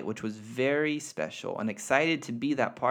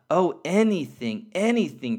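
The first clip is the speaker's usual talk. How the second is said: eager cry; 6 semitones higher, 6 dB louder than usual. Acted. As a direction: plead it out, overjoyed. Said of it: "cried the little soul".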